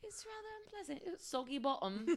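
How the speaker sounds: judge a bake like a TV baker; lightly voiced British baking judge; Paul Hollywood impression. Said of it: "Okay, Paul Hollywood".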